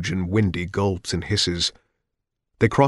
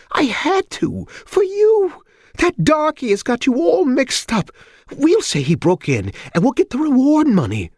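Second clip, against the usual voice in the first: put-on high, loud old mother voice; high querulous old mother; old woman voice; 4 dB louder than usual.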